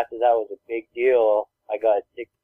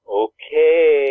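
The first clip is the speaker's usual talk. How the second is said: long drawn-out, louder, higher okay; mock sulky okay; 4 semitones higher, 7 dB louder than usual. Daughter walking off to the living room, imitated sulkiness. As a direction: drag it out like a put-out kid.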